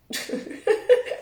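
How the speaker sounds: short breathy disbelieving laugh; incredulous laugh. Real, not acted. Scoffing at critics who called her a conspiracy theorist, short incredulous laugh. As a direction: laugh it off, amazed at the label.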